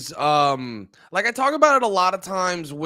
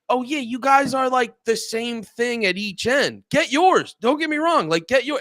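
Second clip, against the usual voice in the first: mock sarcastic cheering; 8 semitones higher than usual. Mocking the union boss on TV, high and sarcastic. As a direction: egg them on with sarcasm. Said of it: "I'm like".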